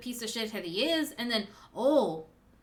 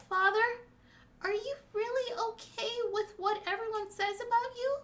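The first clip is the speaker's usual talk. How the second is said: little daughter's voice; 8 semitones higher than usual. Acted. Young Hiyori asking father in a flashback, tender.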